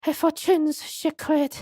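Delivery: put-on high, quick French accent; Esmeralda Tuspels. Madame recounts her grandmother's tradition, high French accent. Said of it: "they don't write the accents down".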